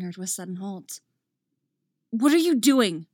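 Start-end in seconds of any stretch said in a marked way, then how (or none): 2.1-3.1 loud, high demand. A narrator's reading. Obi-Wan demands Dooku stop choking Anakin, loud. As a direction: demand it as a shout. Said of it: "she demands".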